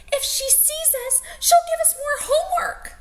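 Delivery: put-on very high, loud panicked child voice; frightened kids. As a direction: squeal in terror. Frightened kids spot Miss Swamp.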